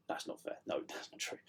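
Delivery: whispered self-correction. Real, not acted. Self-correcting jokey aside.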